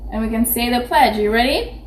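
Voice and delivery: ordinary talk, nothing marked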